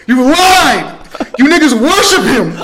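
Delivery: loud laughing rant. Real, not acted. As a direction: yell it while laughing. Laughing rant about lying.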